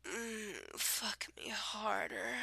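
soft, moaning plea; half-hearted pretend moan, hushed; mock moan. Reading a dirty word list, she fakes a soft moan.

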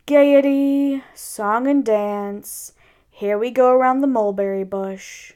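loud first word, then slow and droning; gloomy donkey. Acted. Eeyore answering Pooh on what can't be done, gloomy. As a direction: list the fun with heavy, drawn-out gloom.

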